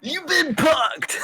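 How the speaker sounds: spring the gotcha line; mock TV host. Player quoting a prank-show host, mock announcement.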